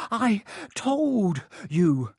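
high, slow, breathless gasp. A narrator's reading. Hermione scolding Harry with a stitch, gasping. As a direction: drag each word out between gasps. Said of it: "Hermione gasped, clutching at the stitch".

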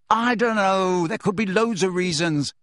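high, loud yell easing off. Acted. Harry yells at Hermione, loud and high. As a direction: yell it at her. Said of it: "Harry yelled at her".

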